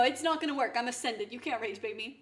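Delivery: usual speaking voice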